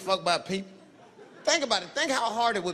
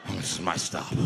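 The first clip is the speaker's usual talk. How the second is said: much lower casual mumble; offhand low voice; 13 semitones lower than usual. Retold or imitated. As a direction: slip away like nothing happened. Homeless dude casually leaving.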